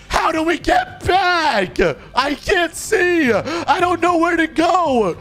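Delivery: mock panic, shouting high; pretend panic. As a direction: yell like you're lost and scared in the dark. Lost in the dark, panicking.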